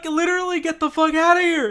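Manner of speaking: high, loud fed-up yell; mock angry yell. Dreamer yelling at the intruding roommate, high fed-up shout. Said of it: "I'm like, I'm like".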